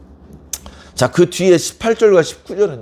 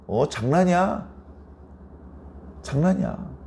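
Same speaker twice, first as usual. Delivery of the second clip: acted casual excuse, softer; joking brush-off; 2 semitones higher, 8 dB quieter than usual. Reckless neighbour excusing the arrows as a joke, joking brush-off. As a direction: play it down as harmless fun. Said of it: "이렇게 말을 한다는 거예요".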